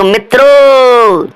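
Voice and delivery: teacher's hammed-up Modi address to the nation; Modi impression. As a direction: stretch the word out like Modi opening a speech. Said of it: "Modi जी दिख रहे होंगे आपको".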